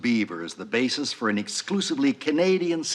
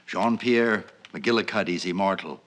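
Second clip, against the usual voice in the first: usual speaking voice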